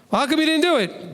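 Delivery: lightly voiced annoyed parent; scolding parent. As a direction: sound like a parent catching the kid out.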